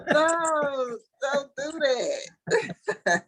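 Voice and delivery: high, drawn-out mock wail; stressed begging. Retelling panic at a client spending money, begging. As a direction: stretch it into a high, pleading cry. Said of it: "I was getting stressed out watching them".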